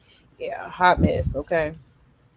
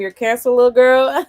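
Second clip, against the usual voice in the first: loud, teasing put-down, pitched up; mocking call-out; 5 semitones higher, 8 dB louder than usual. Real, not acted. Streamer mocking the embarrassing girl, loud and teasing. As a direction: toss the line at her like a verdict.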